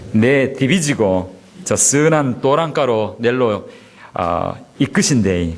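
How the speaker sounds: slow, folksy Gyeongsang dialect recitation, half laughing. Pretend. Pastor reciting Psalm 23 in Gyeongsang dialect to amuse the congregation, slow and folksy. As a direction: lay on the Gyeongsang accent like a country elder. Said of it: "그걸 경상도 말하면".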